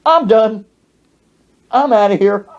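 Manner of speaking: hammed-up, fed-up announcement; fed-up mock outburst. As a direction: declare it in separate exasperated bursts. Quits the party in exasperation.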